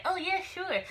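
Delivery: high-pitched sarcastic eagerness; mock agreement. Woman mimicking agreeing to the scam, mocking. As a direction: pitch it way up, pretending to go along.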